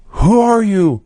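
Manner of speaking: high, drawn-out challenge; dramatic Sting. Acted. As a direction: call it out like a melodramatic wrestler. Acting out Sting confronting Vampiro.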